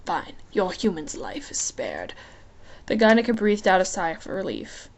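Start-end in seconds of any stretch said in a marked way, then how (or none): 0.0-2.1 a hint of a low, whispery god of the underworld; amused, lazy menace, half whispered; lightly voiced Hades.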